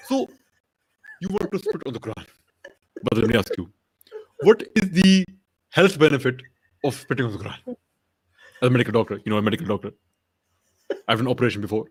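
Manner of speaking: halting mock preacher, dropping low; deliberate, pompous lecturing with pauses; Zakir Naik. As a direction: pose a rhetorical question in short chunks, then go low and soft. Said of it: "what would Zakir Naik say to Armin".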